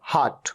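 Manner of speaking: हार्ट low with a long "aa", "haat"; open aa vowel. Shown as a demonstration. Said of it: "ये होता है".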